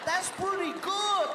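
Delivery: high, slow comedian impression; Paul Rodriguez. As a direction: imitate an impressed comic, high-pitched. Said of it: "I did that to Paul Rodriguez".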